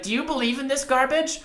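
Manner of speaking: very high-pitched imitated indignation; mock outraged challenge. Imitated moviegoer turning round to confront him, high mock outrage.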